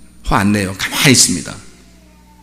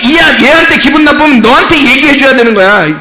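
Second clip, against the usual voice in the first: high, loud preacher's challenge; forceful preaching; 10 semitones higher, 5 dB louder than usual. Real, not acted. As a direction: press the congregation hard, voice raised high.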